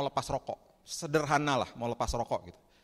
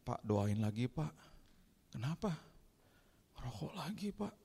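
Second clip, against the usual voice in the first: quiet, halting mock tearfulness; imitating tearful caller; 2 semitones higher, 7 dB quieter, slower than usual. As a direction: confess a relapse to the pastor, close to tears. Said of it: "ada yang datang ke kantor nangis".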